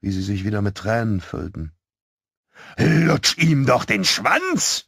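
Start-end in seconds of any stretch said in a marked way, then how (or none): 2.8-4.8 high, drawn-out, loud crude taunt; jeering shout. A narrator's reading. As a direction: yell it slowly across the street like a nasty kid. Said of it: "rief er".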